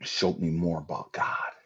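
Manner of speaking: usual speaking voice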